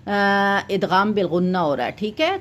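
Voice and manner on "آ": ordinary talk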